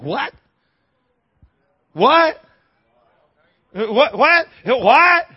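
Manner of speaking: high, loud disbelieving what; incredulous what. Acted. Preacher voicing God's disbelief at the congregation, incredulous. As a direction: throw each what out in disbelief.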